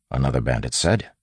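ordinary delivery, nothing marked

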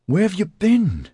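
high, panicky male voice shouting. Acted. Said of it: "he shouted".

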